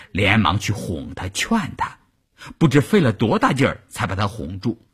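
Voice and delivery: usual speaking voice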